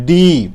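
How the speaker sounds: ডি punched, loud and strong; powerful D. Shown as a demonstration. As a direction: bang out ডি like a drumbeat. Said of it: "ডি মানে খুব powerful".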